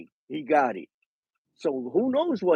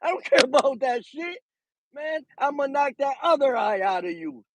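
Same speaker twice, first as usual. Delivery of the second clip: laughing cocky threat; 8 semitones higher than usual. Retold or imitated. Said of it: "Keyshawn is saying".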